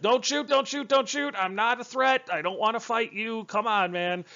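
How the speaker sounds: high-pitched pleading, easing down toward the end; mock panicked plea. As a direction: act out begging a gunman to hold fire. Acted plea at gunpoint.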